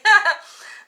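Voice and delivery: big high cackling laugh; loud burst of laughter. Real, not acted. Laughing hard at her own joke to viewers.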